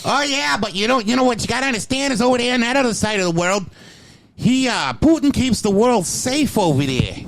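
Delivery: high, fast mocking impression of his friend; mocking friend's voice. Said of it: "he's like".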